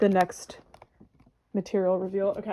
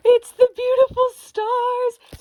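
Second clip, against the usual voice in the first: much higher, louder, slow squeal; reenacted squeal of delight; 14 semitones higher, 10 dB louder, slower than usual. Recreating the unboxing for viewers, a high slow squeal. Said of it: "the sound I made when I got this out".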